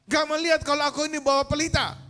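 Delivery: high, loud furious yelling; angry shout. Acted. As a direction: shout indignantly at whoever bumped you. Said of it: "Dia berteriak". (berteriak marah dengan nada tinggi)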